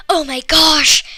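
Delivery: a touch of excited exclaim; girl amazed by her present. A narrator's reading. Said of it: "I exclaimed".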